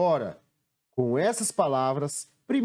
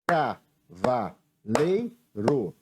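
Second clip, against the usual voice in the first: "Cavaleiro" broken into four slow beats, Ca-va-lei-ro; "Cavaleiro" slowly, a gap after every syllable; 4 semitones lower, much slower than usual. Shown as a demonstration. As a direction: say "Cavaleiro" as if clapping each syllable. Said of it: "é batendo palmas".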